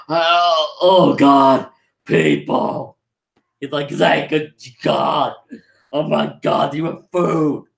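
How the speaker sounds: lightly acted desperate relief; a touch of exhausted disbelief; desperate castaway. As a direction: imitate a worn-out survivor finding help. Starving castaway crawls out of cave.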